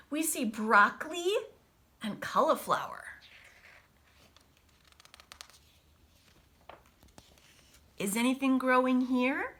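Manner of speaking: lively storybook read-aloud voice; picture-book reading with big pauses; reading to children. Acted. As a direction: read it brightly to a class of kids. Teacher reads picture book aloud.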